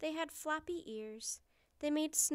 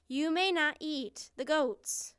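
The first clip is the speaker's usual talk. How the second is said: higher, louder, emphatic ban; insistent refusal; 4 semitones higher, 8 dB louder than usual. A narrator's reading. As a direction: forbid it more forcefully, raising the voice. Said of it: "she said again".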